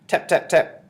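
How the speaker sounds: high, loud, percussive imitation of knocks; spoken knocking effect; loud tapping. A narrator's reading. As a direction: sound out each knock sharply. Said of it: "The bottle made quite a loud tap".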